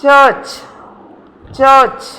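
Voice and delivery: "ചർച്ച്" as chuhch; no r before the last ch of "ചർച്ച്"; silent r. Shown as a demonstration. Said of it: "അവിടെ ആറ് silent ആണ്".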